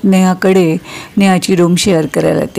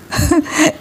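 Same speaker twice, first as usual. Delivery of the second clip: giggle; 8 semitones higher, 4 dB quieter than usual. Acted. Friends joking about mothers.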